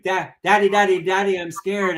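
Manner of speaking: a lightly voiced scared kid; scared child. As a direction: play the frightened toddler calling out.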